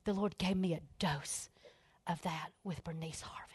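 hushed whisper. Real, not acted. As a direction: lean in and whisper the name. Intimate confession to audience.

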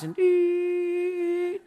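put-on high held beep like an old TV sign-off tone; mock TV test-pattern beep. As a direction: sing one long flat tone like the broadcast test signal. Imitating the TV test tone.

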